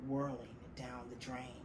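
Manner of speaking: plain reading voice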